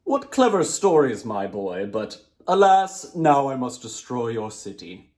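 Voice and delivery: djinn's voice. Acted. Djinn praises the boy before destroying the city, loud and slow. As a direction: praise him, then sigh and doom.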